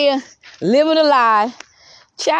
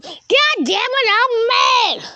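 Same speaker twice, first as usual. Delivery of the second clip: high-pitched mocking tantrum; hammed-up falsetto outrage; mock mad; 10 semitones higher, much slower than usual. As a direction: mimic them fuming in a squeaky drawn-out voice. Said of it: "they be mad as hell at you".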